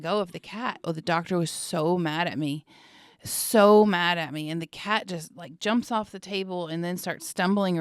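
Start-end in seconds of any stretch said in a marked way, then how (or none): 3.2-4.5 louder, slower for emphasis; stressing how mad he was; emphatic. Real, not acted. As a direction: lean hard into so mad. Repeats for emphasis.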